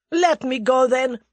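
loud, high demand; desperate cry. A narrator's reading. The Russian cries to be let go, loud. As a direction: cry out to be released. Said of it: "cried the other".

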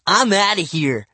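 put-on high, loud huffy kid; sulky. A narrator's reading. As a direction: storm off in a huff.

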